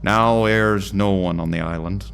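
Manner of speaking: put-on slow old-timey Irish lighthouse boatman; hammed-up Irish accent, taking it slowly. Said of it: "I don't know why I've made him Irish".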